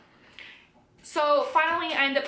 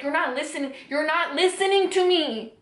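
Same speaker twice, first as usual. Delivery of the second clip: higher-pitched, insistent retelling of a quarrel; acted-out argument, pitch climbing; retold exasperation; 4 semitones higher, 3 dB louder than usual. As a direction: press the complaint harder on the repeat. Acted quarrel after slapping him.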